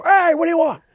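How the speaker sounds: high far-off bar greeter; a squeaky, loud welcome at the door; mock greeter.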